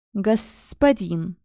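slow hissing Господин; slow serpentine hiss of Господин; snake hiss. Acted. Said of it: "зашипел тот, точно как змея".

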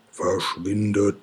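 slow, lightly voiced fearsome god; a hint of a thundering god, drawn out; booming deep voice. A narrator's reading. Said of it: "eine tiefe, furchteinflößende Stimme dröhnt".

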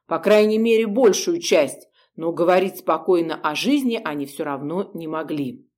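ordinary delivery, nothing marked

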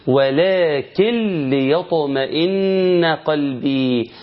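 slow chant, N of "ولكن" merged into the L of "ليطمئن"; idgham without ghunnah. Shown as a demonstration. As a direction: recite "ولكن ليطمئن" as "walakil-liyatma'inna", no nasal hum. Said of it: "وبعدين دخلت في اللام". (تلاوة بإدغام نون "ولكن" في لام "ليطمئن" بلا غنة)

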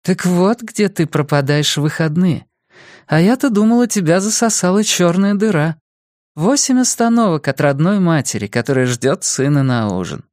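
lightly voiced mother, firm and reproachful; serious tone. Mother reproaching her grown son for missing dinner, serious. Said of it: "добавила она серьёзным тоном".

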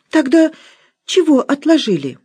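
high, pushy housekeeper; Musya's high voice. Acted. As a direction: Musya demands why Nora stopped eating, high. Musya questions Nora.

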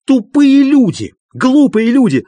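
high, loud raging insult; furious shouting. A narrator's reading. Doctor raging at his two visitors, furious shouting. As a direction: hurl each insult at full pitch. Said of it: "продолжал кричать Андрей Ефимович".

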